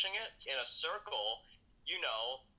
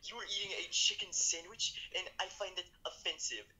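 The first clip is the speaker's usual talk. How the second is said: mock offended vegan; 6 semitones higher than usual.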